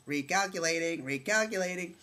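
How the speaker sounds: high, loud mock GPS alert. GPS reacts to a missed turn, hammed-up machine voice. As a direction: imitate a sat-nav stuck repeating itself. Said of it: "your G P S goes".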